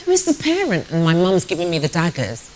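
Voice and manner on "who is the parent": higher, alarmed English bystander voice; mock outrage from prim English passengers; fussy English passengers. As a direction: play a flustered passenger on the bus. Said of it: "the English people would start, you know, saying".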